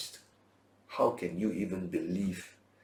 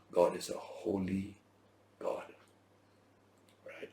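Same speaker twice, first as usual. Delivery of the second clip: ordinary talk, nothing marked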